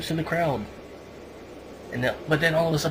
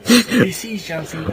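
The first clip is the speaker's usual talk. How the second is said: excited; 6 semitones higher, 9 dB louder, slower than usual. Real, not acted. Excited recap of Orton storming.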